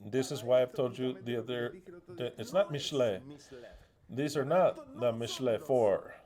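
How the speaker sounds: normal talking voice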